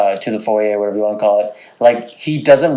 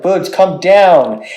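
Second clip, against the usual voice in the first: higher, drawn-out call upstairs; calling to pet; 5 semitones higher, much slower than usual. Retold or imitated. Owner calling cat from downstairs, stretched-out mock call. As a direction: re-enact calling a stubborn cat.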